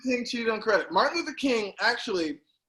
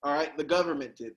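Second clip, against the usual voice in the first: usual speaking voice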